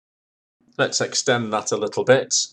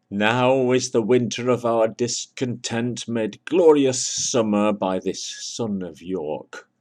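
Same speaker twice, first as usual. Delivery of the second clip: slow, louder theatrical recitation; Shakespeare recital; 4 semitones higher, 5 dB louder, slower than usual. Shown as a demonstration. Teacher reciting Shakespeare to students, slow and grand. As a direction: declaim it like the stage. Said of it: "Here's one from Shakespeare, a famous quote".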